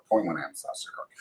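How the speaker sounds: quiet repeated mantra. Retold or imitated. Repeating a thought to himself.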